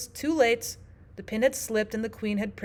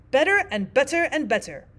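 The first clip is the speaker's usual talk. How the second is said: "Better and better and better" climbing higher, loudly; rising to a squeak; 5 semitones higher, 6 dB louder than usual. A narrator's reading. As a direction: push each "better" higher toward a squeak. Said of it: "Her voice went higher with each better".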